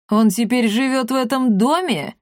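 high puzzled bandit question; surprised. A narrator's reading. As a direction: ask in rough disbelief. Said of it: "удивился Щербатый".

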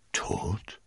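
soft, rising one-word question; disbelieving. A narrator's reading. Cardinal hears that Milady is dead, disbelieving. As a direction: echo it softly, not believing. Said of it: "wiederholte der Kardinal ungläubig".

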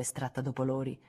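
ordinary delivery, nothing marked

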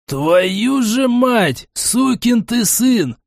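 high, long-drawn wailing curse; lamenting. A narrator's reading. Gnome laments the reckless bet, wailing high. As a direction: wail it slowly at the top of your pitch. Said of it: "продолжал причитать гном".

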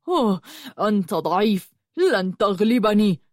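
growled taunt in a villain's voice; al-Barq growling. A narrator's reading. Al-Barq taunting Yusuf before their fight, growling. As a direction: growl it with contempt for a weak opponent. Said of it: "زمجر البرق".

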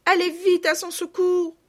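put-on high, loud child's plea; frantic little girl calling out; desolate pleading cry. A narrator's reading. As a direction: beg them urgently to go and help. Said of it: "sanglotait Marguerite, désolée".

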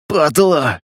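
mild curse through clenched teeth; hissed through teeth. A narrator's reading. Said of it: "процедил Панк". (процедил сквозь зубы)

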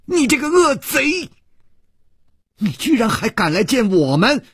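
high-pitched outraged cry. A narrator's reading. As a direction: hurl the accusation high and indignant. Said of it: "高声地说".

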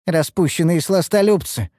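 high, disapproving grumble; mildly disgusted carping; grumbling. A narrator's reading. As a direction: carry on griping at the lovers. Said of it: "продолжила бурчать Нюру".

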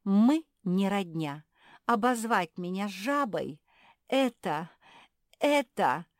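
loud, high, slow affronted outburst; wounded dignity at full volume; indignant offended frog. Acted. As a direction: draw out each word, deeply insulted.

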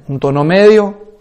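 ordinary talk

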